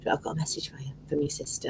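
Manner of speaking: low, unvoiced secretive whisper; a psychic whispering a message from the dead sister; hushed psychic message. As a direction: lean in and whisper it like a mysterious medium.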